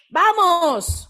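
rally yourself awake with a bright "Vamos"; energetic wake-up cheer; upbeat cheer. Retold or imitated. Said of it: "Pero si digo".

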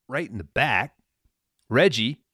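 ordinary delivery

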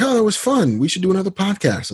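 high-pitched take on an enthusiastic friend; Kevin impression. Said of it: "and he's like".

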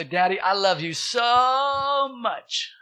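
put-on high little-girl voice, "so" stretched out; imitated little daughter. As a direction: imitate a gushing three-year-old. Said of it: "My little three-year-old daughter".

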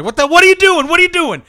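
high, alarmed, horrified yelling at the doctor; shocked outburst. Acted. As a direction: cry out in horror as the baby drops. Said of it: "And William's like".

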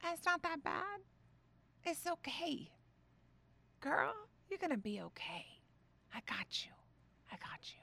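tender whisper-soft reassurance; gentle comforting voice. Retold or imitated. The Holy Spirit comforting her, soft and tender. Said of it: "he spoke to my spirit and said".

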